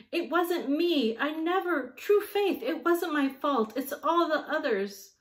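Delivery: put-on high, loud childish protest; a small boy pleading his innocence; wailing boy. A narrator's reading. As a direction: whine it out like a caught kid. Boy wriggles free, protesting.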